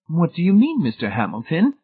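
high, mildly worried question; troubled. A narrator's reading. Mrs Pritchett questions Hamilton about fantasy realms, high and troubled. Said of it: "Missus Pritchett asked, troubled".

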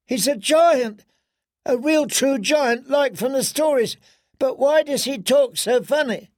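high awed little princess; excited little girl voice. Acted.

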